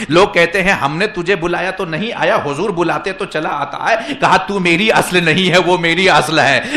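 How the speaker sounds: loud, insistent sermon build; emphatic preacher's climax; forceful preaching. Acted.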